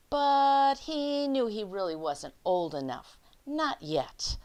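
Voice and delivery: lively picture-book read-aloud; reading to children. Acted. Teacher reading The Snowy Day to her class, lively read-aloud. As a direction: read it out like story time for little kids.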